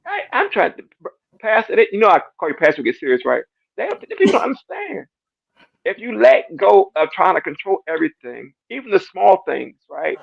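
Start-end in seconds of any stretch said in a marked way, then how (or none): 3.8-5.1 tearful. Real, not acted. Emotional testimony, crying heard.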